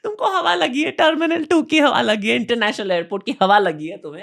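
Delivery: high-pitched, teasing through laughter; mock-scold them, cracking up; incredulous teasing in a high, laughing voice; laughing mockery. Mock-scolding people with sudden accents.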